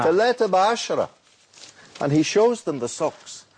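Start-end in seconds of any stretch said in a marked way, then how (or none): 0.0-1.1 street hawker calling. Retold or imitated. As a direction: beckon customers over the crowd noise. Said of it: "he cries out".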